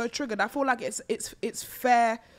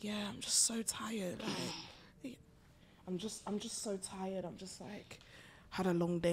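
sexy whisper voice; 3 semitones lower, 14 dB quieter than usual. Retold or imitated. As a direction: whisper it like a flirty night call. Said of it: "Why are you talking like that?".

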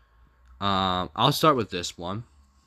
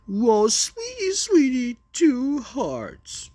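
very high, slow falsetto coo; hammed-up high sweetheart voice; mocking lovey-dovey; 5 dB louder, much slower than usual. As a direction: squeal it in mock adoration.

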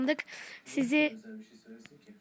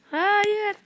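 high, loud cry of no; dismayed shout; 9 semitones higher, 8 dB louder than usual. Real, not acted. Caught moment in game.

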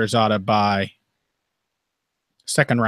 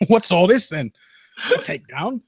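high, hammed-up British bobby; mock police officer, amused; Monty Python policeman; 7 semitones higher than usual. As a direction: play a comic English constable catching a crime. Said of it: "I'm becoming a Monty Python, uh, police officer".

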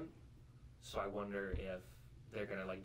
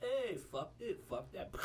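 mock Deadpool voice; 9 semitones higher, slower than usual. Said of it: "and be like".

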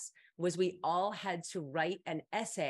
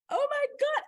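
high, loud mock squeal; acted squeal of delighted recognition; very excited; 6 dB louder than usual. Student spotting a friend on college tour, excited squeal. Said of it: "very excited".

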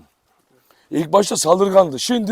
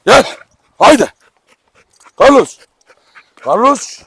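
calling the dog; 6 semitones higher, 7 dB louder than usual. Real, not acted. Köpeği yanına çağırıyor.

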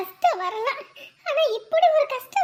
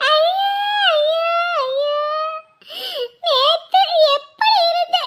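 over-the-top crying; 4 semitones higher, slower than usual. Acted. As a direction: bawl theatrically, slowing on the cries. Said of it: "ஓவரா அழுவுறாளே".

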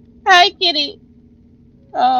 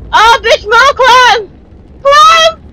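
high, loud panicked cries to climb; panicked yelling; 8 semitones higher, 4 dB louder, slower than usual. Real, not acted. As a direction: scream at her to climb, real fear. Urging character to escape monster.

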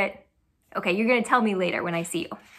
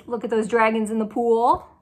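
ordinary delivery, nothing marked

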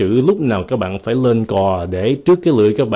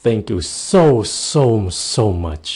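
very slow, drawn-out "so, so much"; gushing, stretched "so, so, so much"; gushing thanks; much slower than usual. Shown as a demonstration. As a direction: melt with exaggerated gratitude. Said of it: "Nụ hôn?".

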